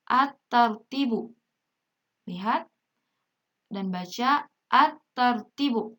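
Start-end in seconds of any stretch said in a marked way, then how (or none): 0.1-1.3 slow "at-tartibu", lam merged into a held T; silent lam. Shown as a demonstration. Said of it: "Alif lamnya tidak dibaca jelas". 4.7-6.0 slow "at-tartibu", lam merged into a held T; silent lam. Shown as a demonstration. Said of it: "Alif lamnya tidak dibaca jelas".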